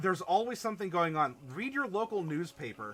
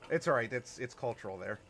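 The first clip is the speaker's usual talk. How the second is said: usual speaking voice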